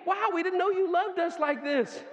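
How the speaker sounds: high, loud, thrilled announcing; excited pastor voice. Retold or imitated. Pastor loudly telling the crowd they will preach twice. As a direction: pastor gushing to the crowd with new plans. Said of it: "He's like".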